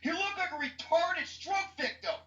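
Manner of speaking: high-pitched outraged disbelief; exasperated rant. Real, not acted. Ranting about hated Joker.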